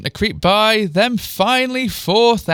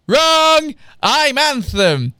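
loud, high and drawn-out WRONG; emphatic correction; 9 semitones higher, 5 dB louder, much slower than usual. A narrator's reading. Twin correcting Bryce about who she is, emphatic and loud. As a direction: crow it, stretching the words. Twin's gleeful correction, acted out.

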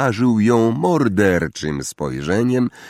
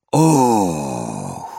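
long falling gasp of wonder; loud awed "oh"; 5 semitones lower than usual. A narrator's reading. First-years catching sight of the castle, awed exclamation. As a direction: let out a crowd's drawn-out "ooh". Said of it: "Rozległo się głośne".

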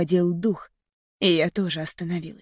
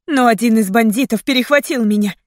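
ordinary delivery

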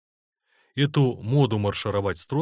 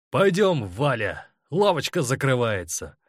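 raised, provocative call; defiantly loud; 4 semitones higher, 4 dB louder than usual. A narrator's reading. Seryozha to Valya before the parade crowd, defiantly loud. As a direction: call out so that everyone around hears. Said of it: "Вызывающе громко".

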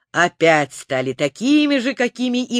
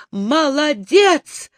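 high, slow cheer; joyful shout; 6 semitones higher, much slower than usual. A narrator's reading. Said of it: "закричал Незнайка". (радостный возглас)

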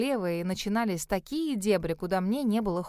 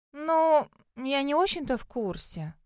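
drawn out; 4 semitones higher than usual. A narrator's reading. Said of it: "протянула она".

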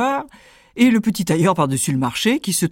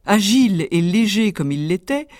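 ordinary delivery

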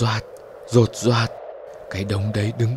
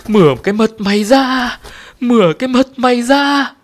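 high, loud shrieking bird voice; screeching bird; 12 semitones higher, 6 dB louder than usual. A narrator's reading. The bird throws its head back and shrieks its curse. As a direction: shriek the curse toward the sky.

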